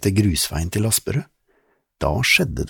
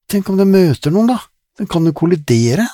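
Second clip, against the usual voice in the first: put-on high, louder girlish voice; girl's voice; 11 semitones higher, 7 dB louder than usual. Lizzie wonders about the unlit car, high girlish. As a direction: wonder aloud like a young girl.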